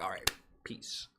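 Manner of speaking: breathy whisper, slow, barely voiced; whispered goodbye. Leaving a hangout abruptly, a whispered quick goodbye. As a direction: slip out with a hushed farewell. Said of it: "I'm gonna be like".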